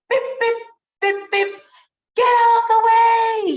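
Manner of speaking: high, loud honking yell; yelling sheep. Acted. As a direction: shout beep beep like an angry driver. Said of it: "he yells".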